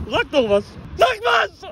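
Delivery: rising loud demand; demanding shout. Real, not acted. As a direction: yell it at him to answer. Urging silent friend.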